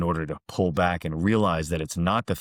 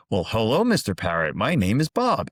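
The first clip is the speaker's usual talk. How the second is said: higher, sing-song acted hello; friendly greeting; 4 semitones higher, 3 dB louder than usual. Third passerby chatting to a parrot, friendly greeting. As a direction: introduce yourself with playful, swooping pitch.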